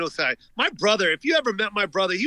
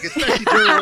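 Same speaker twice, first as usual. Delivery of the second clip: laughing; 14 semitones higher, 8 dB louder than usual. Real, not acted. Laughing mid-sentence.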